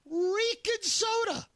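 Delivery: exasperated outburst. Real, not acted. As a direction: blow up at the price of a soda. Ranting about soda prices.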